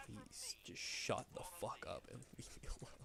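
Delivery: whispered, fed-up plea trailing into a laugh; weary whisper. Retold or imitated. To coworkers teasing his yawning, a tired whisper. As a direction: hush it out like a muttered aside to coworkers. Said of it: "every time I'm like".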